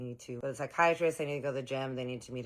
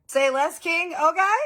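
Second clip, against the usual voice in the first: thrilled squeal; 15 dB louder than usual. Retold or imitated.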